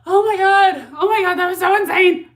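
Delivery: loud, high exclamation of disbelief; shocked excitement. Real, not acted. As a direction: burst out loudly, utterly blown away. Renewed shock at the twist.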